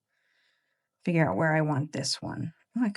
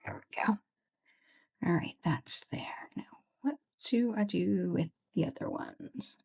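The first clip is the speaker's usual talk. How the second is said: whispering; 2 semitones higher, 6 dB quieter than usual. Real, not acted. Whispering while working on spread.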